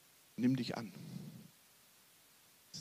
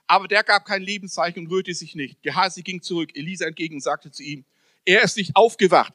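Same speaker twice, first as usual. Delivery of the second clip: louder, declaiming Bible reading; scripture reading; 4 semitones higher, 7 dB louder than usual. Acted. Preacher reading Elisa's story aloud, loud declaiming. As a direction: proclaim the story to the congregation.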